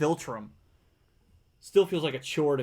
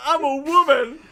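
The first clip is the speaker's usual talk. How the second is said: much higher and louder mock cry; hammed-up movie line; 10 semitones higher, 10 dB louder than usual. Quoting the film's line to friends, mock and loud. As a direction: belt it like an overacting heroine.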